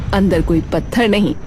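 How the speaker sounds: ordinary delivery, nothing marked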